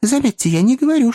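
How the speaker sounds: high-pitched hasty correction; hurried self-correction. A narrator's reading. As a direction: rush to correct yourself. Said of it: "поспешил поправиться мистер Пиквик".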